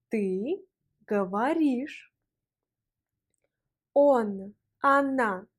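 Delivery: slow, clear Russian drill with pauses; repeat after me. Shown as a demonstration. Teacher drilling a student, each form slow and clear. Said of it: "On répète".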